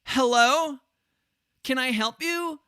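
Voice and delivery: high, questioning badly acted wife voice; mock wife. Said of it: "she's like".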